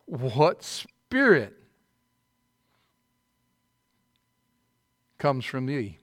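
slow pointed question. Acted. Preacher presses key question.

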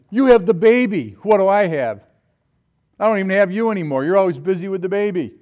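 loud, high, hurt complaint; loud jealous complaint, fast in the middle; jealous outburst. Retold or imitated. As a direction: throw it at her like an accusation. Complaining to his wife about baby.

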